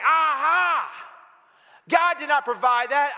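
lightly acted triumphant heckle; imitated gloating neighbor. Atheist jumps from bushes at lady, mock gloating. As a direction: pounce with a mock "gotcha" at the start. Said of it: "And he's like".

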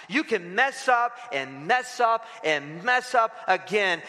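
ordinary talk, nothing marked

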